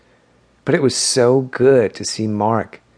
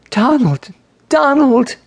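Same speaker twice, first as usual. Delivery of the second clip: urgent call, much higher and louder on the second; calling his name; 13 semitones higher, 4 dB louder than usual. Acted. Grace wakes Donald from his screaming, urgent high calls. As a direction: shake him awake by shouting his name. Sister calling him awake.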